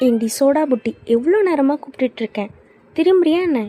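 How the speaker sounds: fed up, calling her out of her daydream; exasperated call. Acted. Friend ignored after repeated calls.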